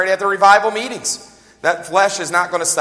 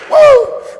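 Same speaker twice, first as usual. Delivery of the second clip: very high, loud "woo"; sudden high-pitched whoop; excited whoop; 11 dB louder than usual. Acted. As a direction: whoop it out as if leaping overboard. Acts out Peter jumping from boat.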